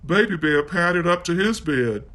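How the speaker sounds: plain reading voice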